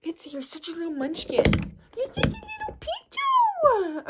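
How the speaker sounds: breathy coo rising to a very high squeal. Real, not acted. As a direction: coo and squeal at a beloved pet. Baby-talk to pet Betsy.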